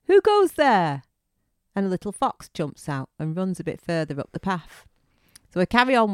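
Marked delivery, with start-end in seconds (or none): 0.1-1.0 high, loud challenge; acted shout. Walker calling toward rustling in the bushes, shouted challenge. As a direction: call out sharply into the bushes. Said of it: "And I shouted".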